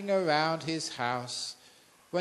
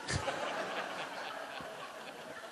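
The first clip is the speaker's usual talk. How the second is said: laugh; 10 dB quieter than usual. Real, not acted. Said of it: "I can really enjoy myself".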